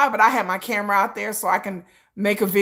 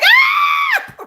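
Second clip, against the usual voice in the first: sudden high shriek, far louder; mock scream; 7 dB louder than usual. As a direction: shriek like he is being grabbed. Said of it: "He'd be like".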